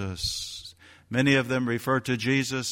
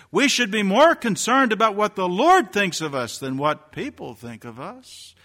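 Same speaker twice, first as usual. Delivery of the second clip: fervent exhortation; 8 semitones higher, 6 dB louder than usual. Acted. Rising exhortation.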